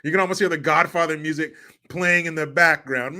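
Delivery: ordinary delivery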